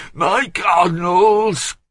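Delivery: high, loud, slow grumble falling hard. A narrator's reading. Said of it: "grumbled Bouville".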